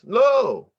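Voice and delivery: "No." shot out high and loud; a sharp, punched, refusing "No."; emphatic no. Shown as a demonstration. Teacher showing how "No" changes with emphasis. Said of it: "your inflection changes".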